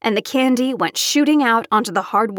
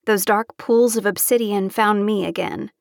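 plain reading voice

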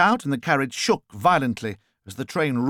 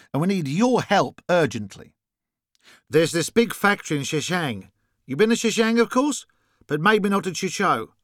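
higher-pitched Australian caller; Australian accent; 6 semitones higher than usual. A narrator's reading. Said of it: "noticing the Australian accent".

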